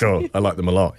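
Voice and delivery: low, lecherous admission; drooling relish. Retold or imitated. Dad answering his stepdaughter's question, drooling relish. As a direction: say it as if dribbling down your chin. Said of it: "did you say it with a dribble".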